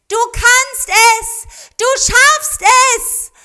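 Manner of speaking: put-on very high, loud, slow children's call; children cheering their swan into the sky, high and loud; calling out together. A narrator's reading. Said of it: "riefen Mariko und Kazuo ihrem Schützling zu".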